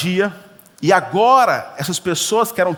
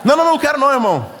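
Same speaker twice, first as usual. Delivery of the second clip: shouted, higher no no no, pushing the offer away; loud refusal; 7 semitones higher, 3 dB louder, much faster than usual. Retold or imitated. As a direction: cry out a flustered refusal.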